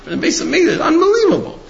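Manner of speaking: very high laughing wonder; amazed. Retold or imitated. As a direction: marvel through a chuckle. Rebbe amazed, laughing at himself.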